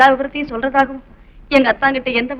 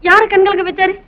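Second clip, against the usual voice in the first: agitated questions; 7 semitones higher, 10 dB louder than usual. Acted.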